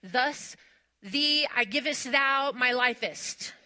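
loud, slow, pompous mock King James prayer; Elizabethan prayer voice. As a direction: ham up an old church prayer. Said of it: "praying some prayer in Elizabethan".

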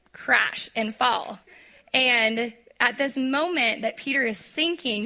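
ordinary talk

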